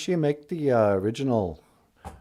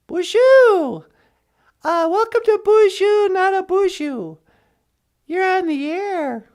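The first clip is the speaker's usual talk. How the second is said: radio host; 6 semitones higher than usual. Pretend. Radio host answering line two, bright and upbeat. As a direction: greet the caller like a radio DJ. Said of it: "radio host voice".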